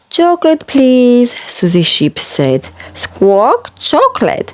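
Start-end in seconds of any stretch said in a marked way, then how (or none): 0.1-1.3 put-on very high small child; little sheep voice. 2.9-4.5 parrot voice. Acted. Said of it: "Polly Parrot copied".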